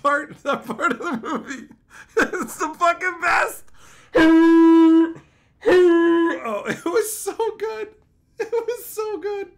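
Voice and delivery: high, squealing laugh through the words; helpless laughter. Real, not acted. Streamer recalling the funniest movie part, laughing hard. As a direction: crack up, voice shooting way up.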